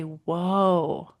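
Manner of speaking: long, drawn-out soothing whoa with a falling end; calm slow whoa. Shown as a demonstration. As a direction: settle the upset child with a slow whoa. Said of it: "we call whoa, low, and slow".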